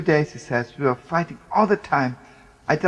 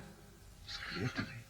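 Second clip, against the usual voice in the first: whisper; 4 semitones lower, 22 dB quieter, slower than usual. Retold or imitated. Moment of revelation retold.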